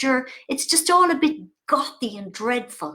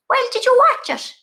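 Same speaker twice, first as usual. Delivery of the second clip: mother's voice; 13 semitones higher, 6 dB louder than usual. Retold or imitated. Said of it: "She goes".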